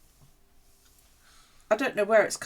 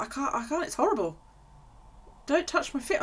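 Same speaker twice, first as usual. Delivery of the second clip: pleading; 8 semitones higher than usual. Retold or imitated. Pleading with the doctor.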